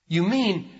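ordinary talk, nothing marked

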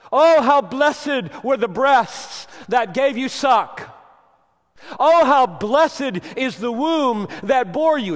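loud, high, slow hammed-up cry of praise; exaggerated mock shout of adoration; mock gushing woman. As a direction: overdo the woman's gushing blessing. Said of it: "And he looks at that woman".

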